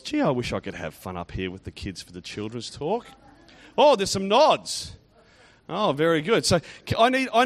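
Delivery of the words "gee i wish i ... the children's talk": low, soft and nearly monotone; wistful inner thought. Acted. Grown-up in church envying the kids up front, murmured wistful thought. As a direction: murmur it like a private wish. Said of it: "that are thinking to themselves".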